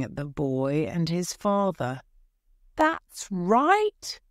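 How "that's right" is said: high, rising cry of approval; old woman crying out, pitch leaping up; old woman's cry. A narrator's reading. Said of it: "cried an old woman".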